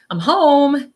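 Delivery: calling out cheerfully, high and loud. Retold or imitated. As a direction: sing out a happy greeting. Spirit voiced arriving home.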